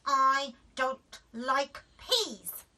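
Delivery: very slow, loud refusal; stubborn child. A narrator's reading. Daisy refusing, drawn out. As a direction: stretch every word out. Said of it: "said Daisy".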